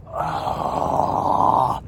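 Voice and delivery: long voiceless "ah" of exasperation; exasperated breath. Retold or imitated. Traveler told his bags will be searched, exasperated exhale. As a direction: breathe out a big fed-up "ahh".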